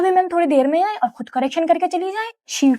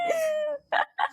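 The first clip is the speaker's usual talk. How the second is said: laugh; 11 semitones higher, 10 dB quieter than usual. Real, not acted. Friends joking about crying.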